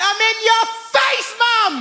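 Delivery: very high, indignant yell; hammed-up cry of exasperation; exasperated shout. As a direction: explode at mum in a high voice. Son snaps at mum's endless calling.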